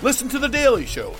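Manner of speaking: ordinary talk, nothing marked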